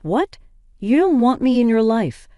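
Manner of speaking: high, stunned disbelief; a mother reeling at the news; shocked. Acted. Mother stunned her son wants her gone, shocked disbelief. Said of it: "Sabrina was shocked".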